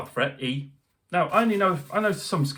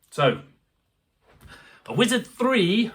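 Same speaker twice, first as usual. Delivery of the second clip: ordinary talk, nothing marked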